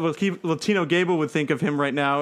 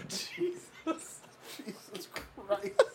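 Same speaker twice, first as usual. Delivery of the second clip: soft, high words squeezed out through laughing; helpless laughter; 5 semitones higher, 12 dB quieter, much slower than usual. Real, not acted. Reader reacts to the burning wrestler scene, laughing helplessly. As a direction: barely get it out while cracking up. Reader overcome by own absurd story.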